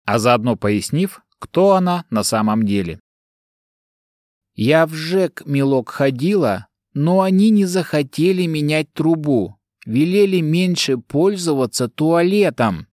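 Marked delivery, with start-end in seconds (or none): 4.6-12.9 put-on high old granny voice, unbothered; unflappable old woman. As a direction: ramble on like a deaf granny. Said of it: "Невозмутимо продолжала рассказывать старушка".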